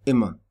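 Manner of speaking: immer with a short I as in "in". Shown as a demonstration. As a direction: say immer with a quick, short first vowel. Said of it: "When it's short".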